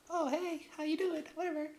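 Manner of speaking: very high falsetto greeting; squeaky put-on hello; mock woman's voice. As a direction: imitate a flirty woman in falsetto.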